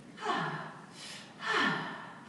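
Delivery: long rising breathy inhale-exhale; breathing demo. Shown as a demonstration. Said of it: "sounded like this".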